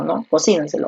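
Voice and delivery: ordinary talk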